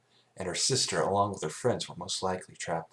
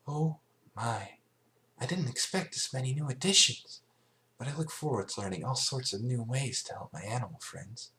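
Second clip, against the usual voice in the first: timid sweet Fluttershy; 6 semitones higher than usual. Acted. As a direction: speak gently and bashfully. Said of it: "smiled sweetly".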